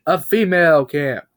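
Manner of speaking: loud, high bulldozer repeat. Acted. Bulldozer echoes Bob about the camp, loud and high. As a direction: bellow it back eagerly. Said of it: "one of the bulldozers said".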